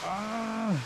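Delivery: high cold yelps; gasping shrieks in icy water; stamping feet for warmth. Real, not acted.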